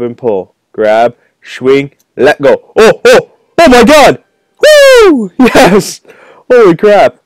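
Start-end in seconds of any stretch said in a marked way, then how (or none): 2.8-4.2 high, loud, mounting disbelief; excited shouting. Real, not acted. Gamer making the rope swing, excited shouting. 4.6-5.3 piercing high, loud woo; victory whoop. Real, not acted. Gamer completing rope swing, piercing victory whoop. 5.4-7.2 high, loud elation; elated shout. Real, not acted. Gamer finishing level quickly, elated shout.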